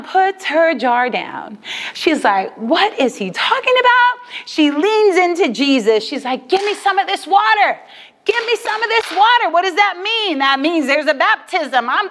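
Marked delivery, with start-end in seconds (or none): 2.6-4.3 high-pitched acted bewilderment; puzzled, pitched well up, playing her reaction; baffled. Acts out woman's bewildered reaction. 6.5-9.5 high, eager acted request; eager plea. Woman eagerly asks Jesus for water.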